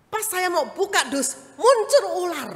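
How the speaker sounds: high, loud rush as the snake appears; startled telling. Retold or imitated. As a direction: relive the shock of the snake.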